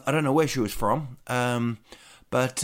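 usual speaking voice

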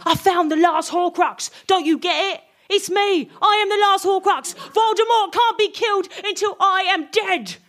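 high, building mock-desperate declaration; melodramatic outburst. Harry's melodramatic revelation.

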